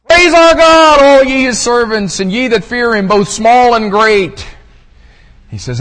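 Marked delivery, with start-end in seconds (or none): 0.0-4.5 booming high shout, easing slightly as it goes; thunderous heavenly summons to praise; voice from the throne. Acted. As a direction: proclaim it at full force, much higher and louder. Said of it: "a voice came out of the throne saying".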